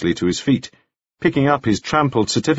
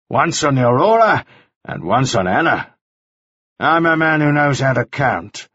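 threatening Mannering; 6 semitones higher than usual. A narrator's reading. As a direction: warn him off coldly. Mannering warns Ah Kui.

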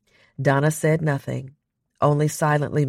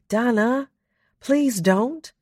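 higher, pleading wheedle; wheedling; 10 semitones higher, slower than usual. A narrator's reading. Brooklyn wheedling Donna not to stay on Mars, high and coaxing. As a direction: coax her like begging a favour. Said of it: "in her most wheedling tone".

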